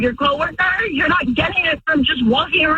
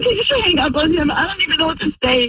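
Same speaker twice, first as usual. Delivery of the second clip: rushed plea; much faster than usual. Real, not acted. Wife rushes to end call.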